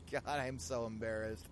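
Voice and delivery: ordinary talk, nothing marked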